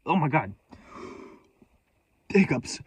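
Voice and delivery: ordinary talk, nothing marked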